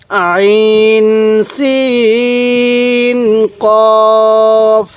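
আইন সিন held very long, কফ short and firm; elongated recitation. Shown as a demonstration. Teacher reciting for viewers, each letter very slowly drawn out. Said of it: "কিভাবে পড়ব?".

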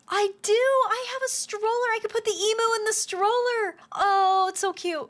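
high, bubbly and thrilled; excited gushing. Real, not acted. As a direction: squeal it with delight. Thrilled about stroller.

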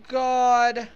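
ordinary talk, nothing marked

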